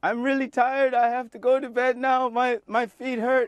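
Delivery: very high, loud mock British accent; terrible British accent. Shown as a demonstration. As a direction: squeal out a deliberately awful British accent. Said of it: "I'm gonna do mine now".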